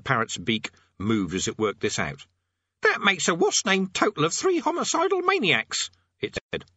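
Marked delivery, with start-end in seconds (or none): 2.8-5.9 high-pitched parrot squawk. A narrator's reading. Parrot working out the sum aloud, high squawk. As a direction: squawk it out as a bird.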